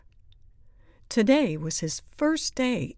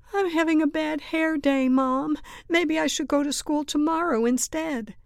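high, loud whine to mom; kid's insistent, high-pitched complaint; whiny excuse; 9 semitones higher, 5 dB louder than usual. A narrator's reading. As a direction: plead your way out of school. Kitten's growing excuses to mom.

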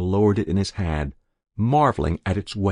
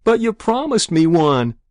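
high, loud whine of protest; protesting plea; 11 semitones higher, 7 dB louder than usual. A narrator's reading. Boy reminding Ma of a promise, protesting. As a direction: hold her to it, whining.

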